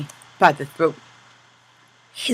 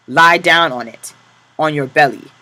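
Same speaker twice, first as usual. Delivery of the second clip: loud command; 9 dB louder than usual. Acted. Capo orders prisoner onto crate.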